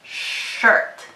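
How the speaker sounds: shirt opening on a long hushed sh; showing the sh in shirt. Shown as a demonstration. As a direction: lean on the sh at the start of shirt. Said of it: "Do you hear the S H digraph".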